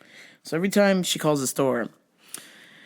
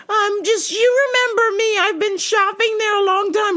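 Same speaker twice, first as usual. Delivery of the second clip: put-on preachy old lady; 4 dB louder than usual.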